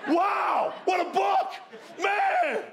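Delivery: very high, full-volume amazement; ecstatic shouting. Retold or imitated. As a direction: holler it across the lawn, stretching words out. Acts shouting on lawn about Bible.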